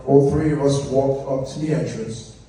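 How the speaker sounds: ordinary talk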